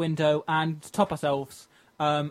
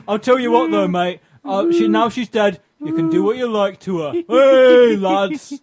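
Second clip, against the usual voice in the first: cockney geezer; 4 semitones higher, 7 dB louder than usual. Acted. Improvised cockney car salesman.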